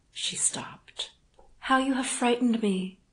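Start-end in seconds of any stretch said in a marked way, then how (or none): 1.6-3.0 higher, louder startled heroine; frightened, breathing hard. A narrator's reading. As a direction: gasp it out in fright. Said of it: "she said, breathing hard".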